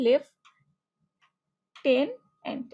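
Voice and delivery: "লেফটেন্যান্ট" broken into slow syllables, lef, ten, ant; child sounding out. Pretend. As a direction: sound out "লেফটেন্যান্ট" piece by piece, long gaps between. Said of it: "আমার মনে হয় এভাবেই বলবে". ("লেফটেন্যান্ট" ভেঙে ভেঙে ধীরে, লেফ-টেন-অ্যান্ট)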